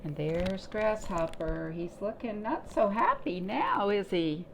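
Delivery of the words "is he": ordinary delivery, nothing marked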